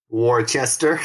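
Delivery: Worcester read out as spelled, like a newcomer; Worcester said the naive, spelled-out way as a fresh arrival; newcomer's pronunciation. Retold or imitated. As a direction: sound out Worcester syllable by syllable, the way a newcomer first would. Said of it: "how did you pronounce it the first time you showed up".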